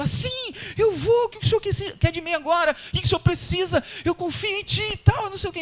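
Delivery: very high, squealing with joy; mock-thrilled high squeal of triumph; excited squealing. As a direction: shriek it out like a thrilled little girl. Woman eager for next level.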